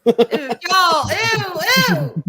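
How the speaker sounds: loud, high squeal of disgust; grossed-out shout. Real, not acted. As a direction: cry out in playful revulsion. Disgusted reaction to the joke.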